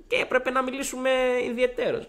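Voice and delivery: high-pitched, held mimicking tone; imitated headmaster. Headmaster wants private word with student, high impression. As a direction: request it in a put-on high voice. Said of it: "μου λέει".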